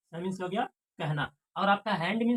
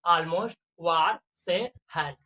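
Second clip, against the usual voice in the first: slow demo reading; 6 dB louder, much slower than usual. Shown as a demonstration. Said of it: "अभी देखिए इसको कैसे करते हैं हम".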